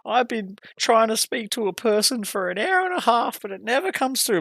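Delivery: high whiny complaining customer; mocking customer. Mocking a caller who complains about waiting on hold. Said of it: "people say when it comes through red".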